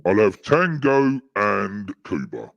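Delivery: put-on voice. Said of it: "Can you go back to normal voice now".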